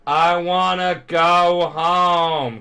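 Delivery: drawn-out, hammed-up screaming; Present Mike. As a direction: scream it out, stretching every word. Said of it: "he's just screaming".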